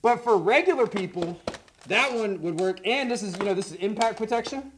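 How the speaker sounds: muffled behind face mask; talking through gas mask. Real, not acted. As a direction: voice inside respirator. Seen 5: Both men wear full-face gas masks throughout the labelled words.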